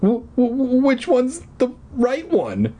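put-on high, stammering frightened boy; scared stammer. A narrator's reading. Eddie, at two stairways, stammering in fear. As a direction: stumble over the first word. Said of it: "he stammered".

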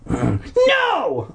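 high, drawn-out cry of refusal. Acted. Boy refusing his dad, crying out. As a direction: cry out in acted protest. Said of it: "I cried".

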